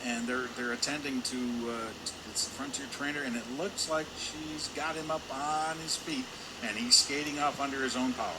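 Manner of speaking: normal talking voice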